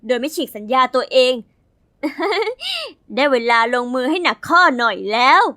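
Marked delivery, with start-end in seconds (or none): 2.0-3.1 short high scheming giggle; a quick high laugh, plotting revenge. Acted. Narrator plans to sabotage her rival, short high giggle.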